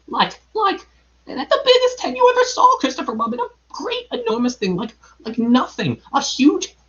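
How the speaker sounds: high, squeaky, stammering fright; flustered Piglet. A narrator's reading. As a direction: grope for words in a tiny panicked voice. Frightened Piglet describes the monster.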